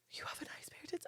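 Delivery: voiceless, hushed quote; whispered mimicry. Woman repeating a stranger's crude compliment, incredulous whisper. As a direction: whisper the crude line back in disbelief.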